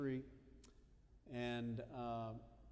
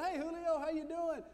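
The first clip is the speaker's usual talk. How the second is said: much higher, bright friendly manager's hello; cheery greeting; 5 dB louder than usual. Retold or imitated. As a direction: greet a regular you're glad to see. Diner manager greets Julio warmly.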